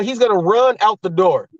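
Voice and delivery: usual speaking voice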